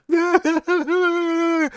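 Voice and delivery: high, unhinged mock laugh; hysterical cackle. Spurned woman going berserk at the party, high crazed laughing. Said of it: "And she's going".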